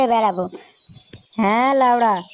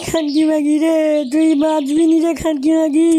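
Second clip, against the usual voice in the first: higher, dragged-out comic wail; mock wailing; 4 semitones higher, slower than usual. Husband wailing after his wife hit him, hammed-up crying. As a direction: whine it like a beaten husband.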